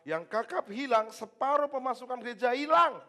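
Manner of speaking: high, loud, laughing mock panic; mock alarm. Joking pastor losing a rich member, loud and laughing. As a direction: play the pastor panicking over money.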